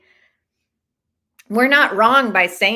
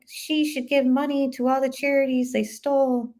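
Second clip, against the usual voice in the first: higher, indignant commenter voice; mock outraged commenter; 6 semitones higher than usual. Quoting angry commenters, higher indignant voice. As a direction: play the angry online crowd. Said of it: "are just like".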